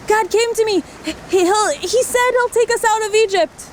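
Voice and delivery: high, lightly voiced Moses. Moses telling the tired Israelites, high voiced. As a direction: lift into a boyish Moses.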